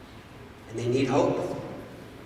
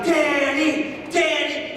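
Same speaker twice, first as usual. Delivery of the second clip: crowd shouting a name; 9 semitones higher, much slower than usual. Retold or imitated. Dinner crowd cheering for Danny, high shouted chant. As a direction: call the name like a cheering room. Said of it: "they were shouting".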